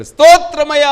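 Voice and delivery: high, loud cry of praise; a sufferer calling out loudly. Acted. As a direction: shout it out in need. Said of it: "గట్టిగా అర్థం ఉంటారు".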